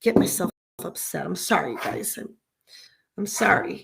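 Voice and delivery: soft, choked-up apology; upset apology. Real, not acted. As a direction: apologize quietly, fighting tears. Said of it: "I'm getting too upset".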